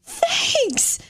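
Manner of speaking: high, slow, gushing thanks; overjoyed at the free gift; a friend imitating her own thrilled thank you, high and slow; delighted surprise.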